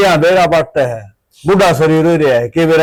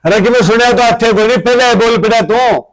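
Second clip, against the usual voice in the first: angry scolding; 7 semitones higher than usual. Acted. Father scolding son for interrupting.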